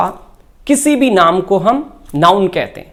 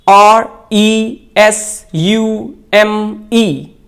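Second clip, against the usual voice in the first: slow, letter by letter spelling of R E S U M E; spelled out letter by letter; much slower than usual. Shown as a demonstration. Teacher spelling the word out slowly for students. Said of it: "इसका correct pronunciation".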